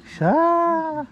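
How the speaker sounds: very high surprised shout; startled cry. Real, not acted. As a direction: cry out in sudden alarm. Sudden shout at the fish.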